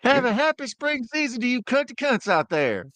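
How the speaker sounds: mock announcer. Mock seasonal greeting in voice.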